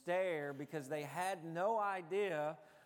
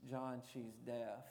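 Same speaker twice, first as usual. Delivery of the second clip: low, soft, voiced aside; retold whisper; 5 semitones lower, 6 dB quieter than usual. Pastor quietly tipping off his embarrassed friend, retold whisper. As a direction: lightly imitate a discreet word in someone's ear. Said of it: "I walked up and whispered to him".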